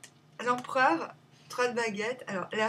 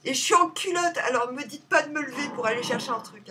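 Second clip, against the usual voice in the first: raised, higher exasperated complaint; loud protest; 3 semitones higher, 8 dB louder than usual. Real, not acted. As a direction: protest loudly at being made to get up. Loud protest at being disturbed. (protestation à voix forte)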